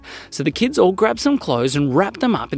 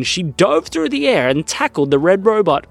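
plain reading voice

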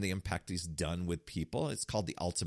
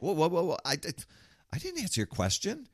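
taken aback; 9 semitones higher than usual. Retold or imitated. Linebacker protests coach's twist.

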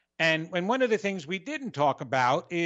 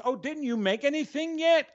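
high-pitched accusing question; mock criticism; 9 semitones higher than usual. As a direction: imitate a pushy complaint about dinner. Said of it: "can come across as".

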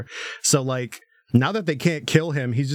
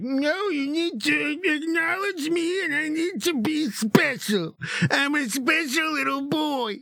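pitched-up mock whining villain; whiny villain; 12 semitones higher than usual. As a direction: whine like a spoiled child. Voicing gravity guy's speech.

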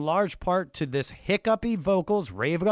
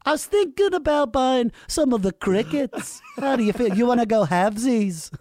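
higher, eager pitch to a bandmate; 6 semitones higher, 4 dB louder than usual. Pretend. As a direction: sell the idea with big enthusiasm. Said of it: "He was like".

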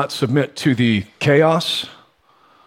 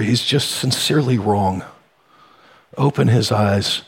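prayer; 3 semitones lower than usual. Real, not acted. Pastor to God for the congregation, prayerful. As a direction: pray it humbly and earnestly.

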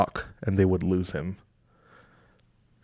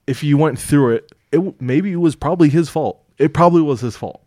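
louder and higher, insisting; emphatic; 6 semitones higher, 5 dB louder than usual. Real, not acted. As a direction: push the point hard. Heated opinion on blame.

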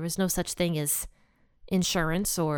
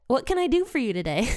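quick, higher quote, tipping into laughter; amused repeat; 4 semitones higher, faster than usual. Retold or imitated. Teacher relishes Jesus' funny question, amused and quick. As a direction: repeat it delightedly, about to laugh.

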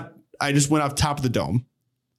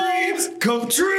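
mock hype shout; slower than usual. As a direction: belt it like a hype man. Joking hype chant together.

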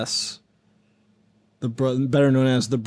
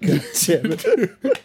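"God damn it," drawn out slowly, higher and laughing; mock Patrick Stewart; 5 semitones higher, much slower than usual. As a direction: stretch "God damn it" like a spoofed raging coach. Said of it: "spoofs it perfectly with Patrick Stewart".

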